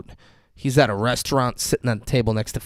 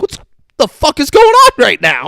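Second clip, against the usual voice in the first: panicked yell, higher and louder; alarmed shout; 12 semitones higher, 9 dB louder than usual. Retold or imitated. Imagined victim yelling at the stranger who spied on him, alarmed. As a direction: shout it in freaked-out disbelief. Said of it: "I'd be like".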